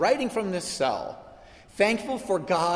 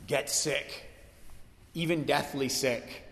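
usual speaking voice

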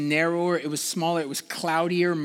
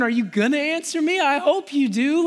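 raised, quicker worried plea; anxious pleading; 7 semitones higher, 4 dB louder than usual. Retold or imitated. Preacher voicing David's worried plea to God, raised and quick. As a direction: press God for an answer. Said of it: "pleading with God".

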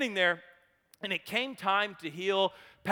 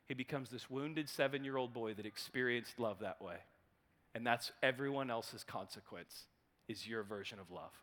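soft, tender insight; 8 semitones lower, 10 dB quieter than usual. Retold or imitated. Therapist gently naming the man's childhood wound, soft. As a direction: speak to the hurt boy inside. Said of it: "And Wayne said".

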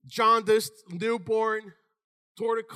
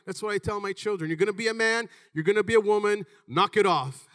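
ordinary delivery

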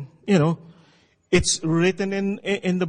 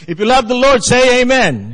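loud, raised preacher call to congregation; preaching call; 5 semitones higher, 8 dB louder than usual. Acted. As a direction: rouse the crowd for an amen. Preacher calls congregation response.

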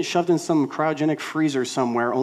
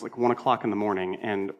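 usual speaking voice

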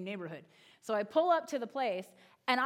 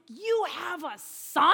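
loud, stretched question climbing high; mock amazement, drawn out to a high peak; astonished kid; 5 semitones higher, 7 dB louder, much slower than usual. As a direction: imitate a child's stunned disbelief. Imitates child astonished by news.

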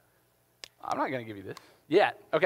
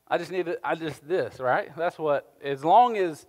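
normal talking voice

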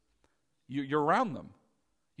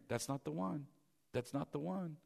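hushed, much softer voice; quiet inner warning; 9 dB quieter than usual. Acted. The Lord warning inwardly about a marriage, hushed. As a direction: drop to a soft, insistent caution.

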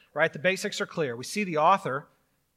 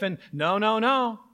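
mock zealot; 6 semitones higher, 7 dB louder than usual. Mocking a literalist interpreter.

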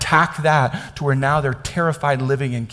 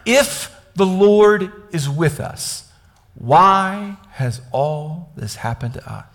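very slow, word by word, opening raised; slow, deliberate reading; 3 semitones higher, much slower than usual. Shown as a demonstration. Preacher reading Gideon's doubt to the congregation, slow and pointed. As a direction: read it slowly, weighing each word. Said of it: "I'm gonna read this slowly".

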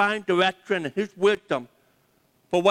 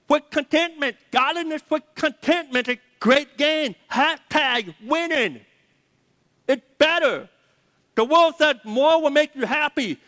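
higher, driving preacher voice, building on each "better"; full-force preaching; 6 semitones higher than usual. Real, not acted. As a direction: preach it home to the congregation, fired up.

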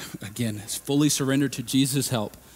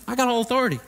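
voicing Jesus; 11 semitones higher, much faster than usual. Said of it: "He says".

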